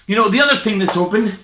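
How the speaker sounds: normal talking voice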